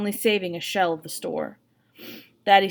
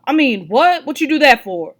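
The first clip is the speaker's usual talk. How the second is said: louder, higher stern What; 6 semitones higher, 7 dB louder than usual. A narrator's reading. Father correcting himself for his wife, stern. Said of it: "he says in a more stern tone".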